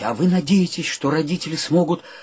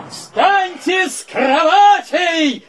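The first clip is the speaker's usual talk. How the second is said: slow, drawn-out shout from the doorway; 10 semitones higher, much slower than usual. Acted. Gromov shouting to his roommates to get up. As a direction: bellow it so the whole flat hears.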